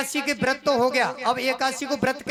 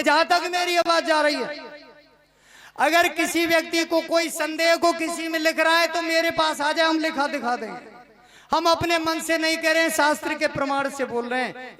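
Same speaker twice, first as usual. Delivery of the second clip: preaching to the crowd; 4 semitones higher than usual. Real, not acted. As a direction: call out firmly to the whole gathering.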